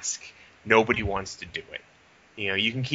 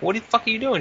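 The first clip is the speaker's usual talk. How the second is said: outraged; 13 semitones higher, 4 dB louder than usual. Retold or imitated. Outraged at manager.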